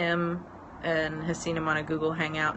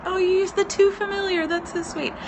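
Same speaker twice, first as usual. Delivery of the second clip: much higher, touched "oh"; delighted; 14 semitones higher, 4 dB louder than usual. Real, not acted. Reacting to a viewer's friendly French, high delighted. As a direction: squeal with delight at the message.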